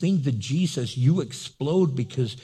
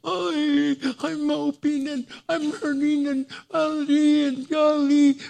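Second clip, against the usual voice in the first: high, whiny mock moping; hammed-up self-pitying whine; 11 semitones higher, slower than usual. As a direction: wail it like someone milking self-pity. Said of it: "Moping is".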